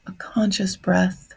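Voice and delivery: ordinary talk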